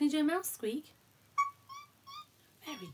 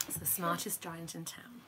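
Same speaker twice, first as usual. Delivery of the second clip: whispered title; hushed bedtime whisper; quiet and secret; 4 semitones lower, 11 dB quieter than usual. Real, not acted.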